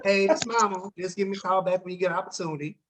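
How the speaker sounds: mom's low-key phone message; typical dull voicemail. Acted. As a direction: leave a subdued, unhurried callback request. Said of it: "this is what most people do".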